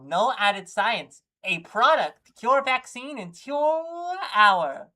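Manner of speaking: loud, punchy sales pitch; advert read. Acted. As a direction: belt out each claim like a pitchman.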